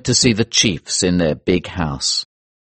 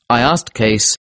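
plain reading voice

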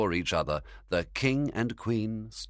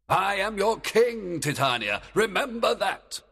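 raised, forceful kingly order; stern command; 13 semitones higher, 4 dB louder than usual. A narrator's reading. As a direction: command her sternly, as an angry king. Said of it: "he commanded in a stern voice".

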